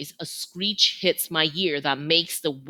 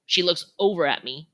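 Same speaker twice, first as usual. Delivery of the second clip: ordinary delivery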